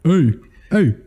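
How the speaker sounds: lightly imitated streamer greeting; Markiplier. Co-host copying fan-dub voice, mock YouTuber. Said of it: "he's doing a Markiplier voice".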